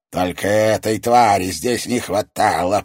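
put-on venomous old man's hiss; hissed in anger. A narrator's reading. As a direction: spit it out with loathing. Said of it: "прошепел он".

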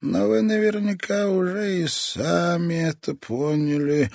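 put-on high, slow, sinister old toymaker; a menacing old man savouring every word; creepy old man voice. Old toy master speaks.

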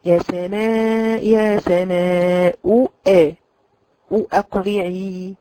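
يا سماء أقلعي recited very slowly and drawn out; teacher modelling يا سماء أقلعي slowly; slow recitation. Shown as a demonstration. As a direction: chant it like Quran recitation. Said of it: "مثلًا تقولي".

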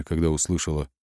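usual speaking voice

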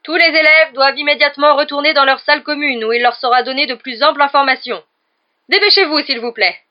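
urgent megaphone command; shouting into a megaphone. Acted. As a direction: McGonagall ordering the students out over the crowd. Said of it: "continua de crier dans son mégaphone".

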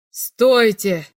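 loud call to stop; commanding shout. A narrator's reading. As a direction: halt the room with one word. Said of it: "крикнул Оверкранц".

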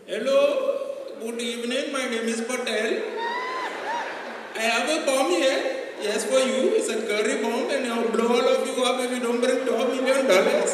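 high, loud mock Indian bomber; comic Indian accent. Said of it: "the Indian accent and the Ghanaian accent".

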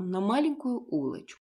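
ordinary delivery

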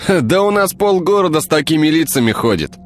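high wry smirking remark. A narrator's reading. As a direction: toss off a dry joke. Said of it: "усмехнулся Артём".

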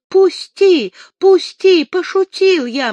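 high, loud frightened begging; panicked plea; pleading. A narrator's reading. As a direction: beg to be let go, voice up high. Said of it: "молил князёк".

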